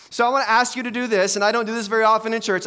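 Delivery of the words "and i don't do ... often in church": ordinary talk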